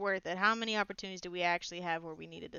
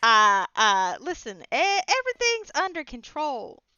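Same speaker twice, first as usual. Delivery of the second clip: nervous, too high; trying to sound calm; 5 semitones higher, 9 dB louder than usual. Acted. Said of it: "you got a weird tone going on".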